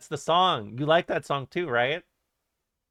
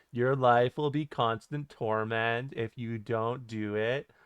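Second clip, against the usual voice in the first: droning horror-list narrator, odd lilting phrase ends; mock creepy countdown narration; Chills. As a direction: recount horrors in a deadpan singsong. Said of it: "when he's like".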